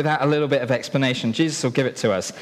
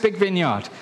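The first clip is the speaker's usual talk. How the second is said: ordinary talk, nothing marked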